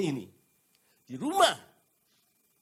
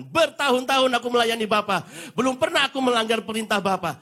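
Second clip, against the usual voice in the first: self-righteous boast; 6 semitones higher, 7 dB louder than usual. Acted. Older son boasting to father.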